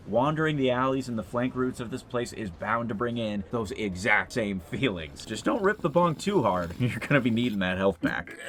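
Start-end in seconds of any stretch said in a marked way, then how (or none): none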